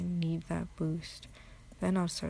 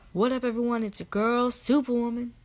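loud, higher YouTuber intro; Superwoman; 4 semitones higher, 7 dB louder than usual. Acted. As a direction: belt out a hyped channel greeting. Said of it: "YouTuber Superwoman, she has this intro".

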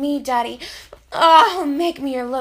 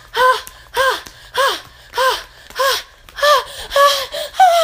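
high, loud, breaking cries; climactic moaning cries; 10 semitones higher, 7 dB louder, slower than usual. Acted. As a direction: cry out at the peak, voice cracking. Climax, crying out.

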